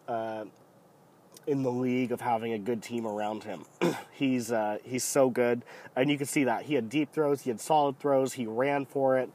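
usual speaking voice

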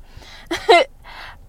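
high giddy laugh; gushing giggle. Real, not acted. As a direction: laugh with delight, a bit high. Laughs about favorite anime.

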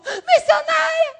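high, drawn-out mock wail; crying girl. Weeping girl calling the missionary from below, high imitated wail. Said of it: "veio uma menina chorando".

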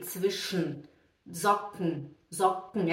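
Zwischen and Socken as "tsvish-n", "zok-n"; low, falling, ending Zwischen and Socken on a bare n; E dropped. Shown as a demonstration. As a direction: Socken with k released straight into n. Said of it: "É a mesma coisa aqui".

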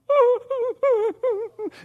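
put-on high wailing cub like a crying kid; imitated child's crying. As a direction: wail in short, high bursts like a little child. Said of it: "sounded like a little kid crying".

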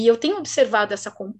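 ordinary talk, nothing marked

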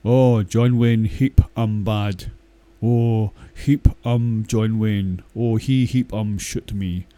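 loud, slow, stilted Western movie caricature; hammed-up movie Indian chief, slow and loud; mock cowboy-film Indian voice. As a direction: boom it out slowly like an old Western film. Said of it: "I'm gonna make a wee noise".

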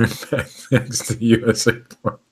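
news read breaking up with laughter; words dissolving into a laugh; tickled. Real, not acted. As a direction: try to read on while giggling. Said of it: "He's getting all tickled".

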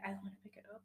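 tiny whispered mumble; very soft, withdrawn whisper; tearful reluctance. Acted. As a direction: barely get it out, almost no voice. Maya sulks at doorbell.

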